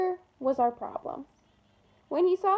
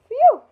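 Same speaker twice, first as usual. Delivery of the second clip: loud, high blown-out phew; big relieved phew; 12 semitones higher, 14 dB louder than usual. A narrator's reading. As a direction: let out a huge breath of relief. Frizz arrives relieved.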